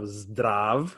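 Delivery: zdrav said slowly as its own syllable; slow, isolated zdrav; first syllable alone. Shown as a demonstration. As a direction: break off zdrav to show it. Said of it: "So first syllable".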